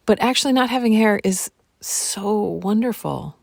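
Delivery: normal talking voice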